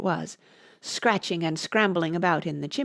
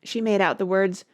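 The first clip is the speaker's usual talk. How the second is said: ordinary delivery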